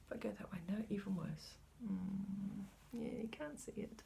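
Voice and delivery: hushed self-talk; whispered aside. Real, not acted. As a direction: mutter it under your breath. Whispering while angling fabric.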